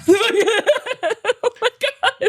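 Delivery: squeaky high laugh through the words; laughing so hard the words stretch out. Real, not acted. Laughing at his own joke, squeaking the words.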